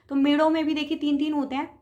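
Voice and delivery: normal talking voice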